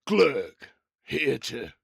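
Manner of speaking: big bruiser making an entrance; armored giant Glurg. Acted. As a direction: boom out the name, then announce grandly. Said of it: "as he walks up, he goes".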